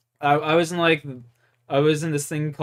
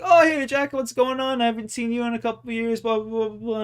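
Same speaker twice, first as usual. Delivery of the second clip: put-on high and fast, an overly chummy old acquaintance; squeaky eager pal rattling off a greeting; eager buddy voice; 7 semitones higher than usual. Imitating his buddy greeting him in the principal's office, high and fast.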